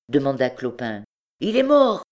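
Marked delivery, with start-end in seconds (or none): 1.4-2.0 high, louder woman's voice; beggar woman. A narrator's reading. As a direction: answer high and sharp, as a woman in the crowd. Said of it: "répondit une truande".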